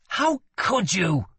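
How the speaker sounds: high, slow, outraged reproach; wounded outrage at a brother; accusing. A narrator's reading. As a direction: accuse him, pitch leaping up. Prince reproaches brother, fists curled.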